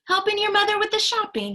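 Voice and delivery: high, fast friendly shopkeeper; friendly grocer. Acted. Grocer greeting the little girl, high and friendly. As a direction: chirp it like a kindly man at the till.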